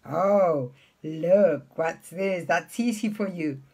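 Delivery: ordinary talk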